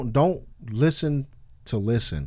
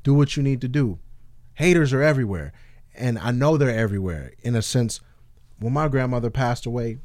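normal talking voice

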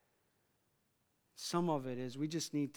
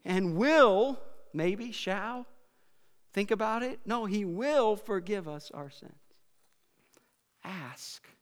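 normal talking voice

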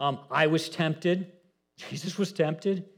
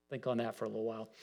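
hushed aside; 4 semitones lower, 10 dB quieter, much faster than usual. Real, not acted. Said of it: "slowed reflection".

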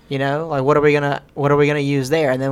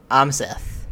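ordinary talk, nothing marked

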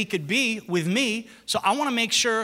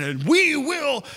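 dad preaching; 6 semitones higher, slower than usual. Retold or imitated. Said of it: "And he said".

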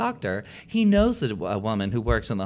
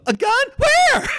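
shrill, much higher, louder mock-innocent "A gun? Where?"; mock innocent squeal; 9 dB louder, slower than usual. Telling the ER nurse about the gun, in a mock innocent high squeal. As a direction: play dumb in a sky-high squeal. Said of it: "And I say, and I quote".

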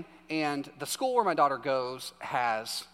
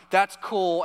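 loud, raised-pitch proud dad holding it together; retold emotional; 4 semitones higher, 7 dB louder than usual. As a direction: play a dad getting choked up, lightly hammed-up. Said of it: "I was getting so emotional".

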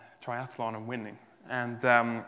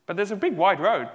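emphatic contrast; 6 semitones higher, 9 dB louder than usual. Acted. Preacher contrasting wide and narrow.

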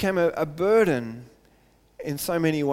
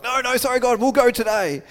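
higher, hurried sheepish apology; mock hasty backpedal; 6 semitones higher, 5 dB louder than usual. People hastily backpedalling to God, higher and sheepish. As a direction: play the people caught out and scrambling.

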